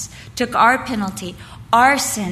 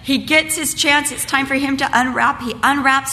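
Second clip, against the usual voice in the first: usual speaking voice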